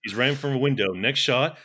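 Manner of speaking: usual speaking voice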